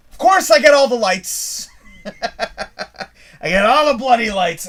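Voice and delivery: laughing exasperation at the lights. Real, not acted. Stuck at every red light, laughing in frustration. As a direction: laugh through it, slow and fed up.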